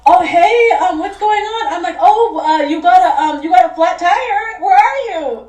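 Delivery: high, loud fake-casual phone voice; hammed-up surprise for an eavesdropper; unnatural phone act. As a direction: overplay a staged phone call. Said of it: "I could have been more, like, naturally".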